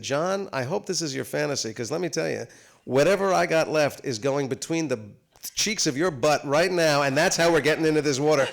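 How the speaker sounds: building to a loud, comic protest; mock indignant rant. Actor warning his co-star before the freezing water, mock indignant rant.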